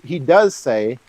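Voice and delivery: ordinary talk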